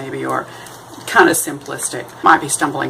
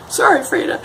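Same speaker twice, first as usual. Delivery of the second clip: playful apology. Real, not acted. Joking apology to Kahlo.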